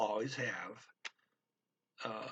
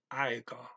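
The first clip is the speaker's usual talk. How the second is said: "aika" with the emphasis on "ai"; first-syllable stress. Shown as a demonstration. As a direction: hit the first syllable of "aika", Finnish style. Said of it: "put the emphasis on the first".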